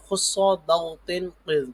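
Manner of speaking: خص، ضغط، قذ spelled out slowly, syllable by syllable; slow teaching drill of the memory words. Shown as a demonstration. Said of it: "پڑھ لیجئے". (آہستہ آہستہ سبق کی طرح)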